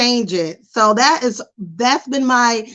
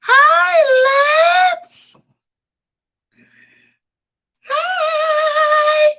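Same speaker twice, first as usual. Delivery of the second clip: gushing fan impression; much slower than usual. Imitating a fan greeting her on a live stream, squealing high. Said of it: "people still will".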